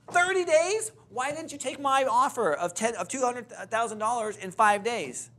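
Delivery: high, loud disbelieving cry; incredulous. Retold or imitated. Realtor repeating the seller's answer in loud disbelief.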